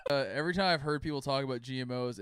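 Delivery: normal talking voice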